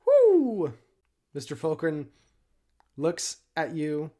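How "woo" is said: high, loud "woo"; big celebratory cheer; excited whoop. Real, not acted. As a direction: whoop at a lucky roll. Cheers the natural twenty.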